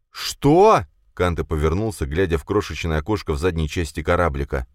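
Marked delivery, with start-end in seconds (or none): 0.1-0.8 high, loud stunned cry; shocked. Acted. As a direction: cry out in disbelief. Prince's stunned outcry.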